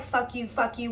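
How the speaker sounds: ordinary talk, nothing marked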